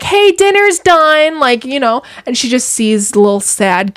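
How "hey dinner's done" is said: high, slow call upstairs; calling up the stairs. Retold or imitated. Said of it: "call up the stairs".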